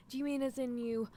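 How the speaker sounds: usual speaking voice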